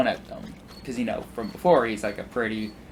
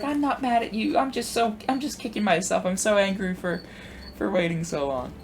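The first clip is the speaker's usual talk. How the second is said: put-on high-pitched old lady kicking herself; flustered old lady; 8 semitones higher than usual. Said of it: "this sweet old lady".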